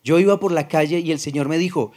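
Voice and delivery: usual speaking voice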